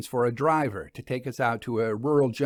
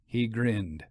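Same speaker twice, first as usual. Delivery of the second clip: ordinary delivery, nothing marked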